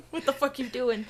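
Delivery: ordinary delivery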